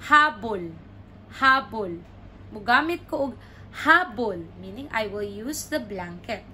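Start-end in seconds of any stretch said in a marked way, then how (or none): 0.1-2.0 "Habol" as loud HA, dropping bol; stress on first syllable. Shown as a demonstration. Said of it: "the stress is in the middle". 3.8-4.5 "habol" with loud HA; HA-bol, falling on "habol"; stress on first syllable. Shown as a demonstration. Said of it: "the stress is in the middle".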